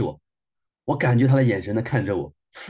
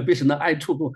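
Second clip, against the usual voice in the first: ordinary talk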